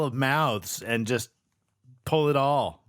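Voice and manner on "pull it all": ordinary delivery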